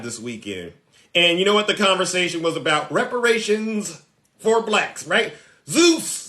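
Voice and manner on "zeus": mocking a follower. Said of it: "this is what they say".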